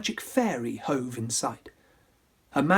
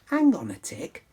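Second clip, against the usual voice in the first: fairy voice. Acted. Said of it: "The fairy said".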